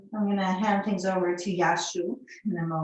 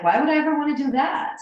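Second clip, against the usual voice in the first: quick, loud, higher mock disbelief; incredulous; 6 semitones higher, 5 dB louder, much faster than usual. Teacher mocking her old self in disbelief. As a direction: toss it off as if it's absurd. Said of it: "Now I'm like".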